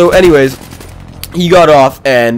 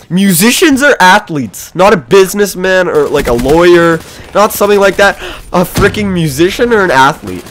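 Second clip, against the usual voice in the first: can you believe this, higher; telling viewers, higher incredulous repeat; incredulous disbelief; 6 semitones higher than usual. Real, not acted. Incredulous at stranger's advice.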